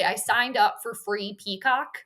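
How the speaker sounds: ordinary talk, nothing marked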